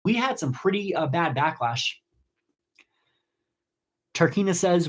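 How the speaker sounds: ordinary delivery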